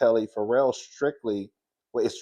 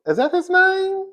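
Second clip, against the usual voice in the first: surprised; 7 dB louder than usual. Real, not acted. Unsure of ex-husband's name.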